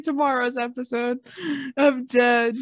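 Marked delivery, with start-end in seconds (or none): none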